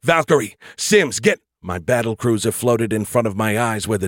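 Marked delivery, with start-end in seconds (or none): 0.0-1.4 urgent shout. Acted. Urgent command in crisis.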